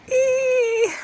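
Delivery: high squeal of disgust. Real, not acted. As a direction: let out a shrill eek at the bug. Tick found in their tent.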